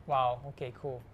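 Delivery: ordinary delivery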